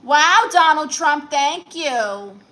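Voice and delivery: loud, drawn-out wow of gratitude; excited thanks. Real, not acted. Streamer thanks a generous viewer, excited. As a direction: gush a big slow thank-you, starting high.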